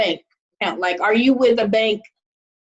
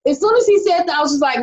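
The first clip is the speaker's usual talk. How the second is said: ordinary talk, nothing marked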